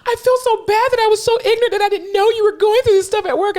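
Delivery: very high-pitched tearful wail; mock crying. Colleague apologizing while trying to console her, mock crying. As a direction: blubber apologies in an exaggerated high voice. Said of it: "crying even more".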